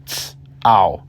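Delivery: "ts" then "ow" said as two separate parts; split syllable. Shown as a demonstration. Teacher splitting tao into sounds for learners. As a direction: break "tao" into ts plus ow. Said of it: "pronounced roughly like".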